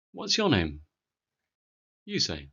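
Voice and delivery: ordinary talk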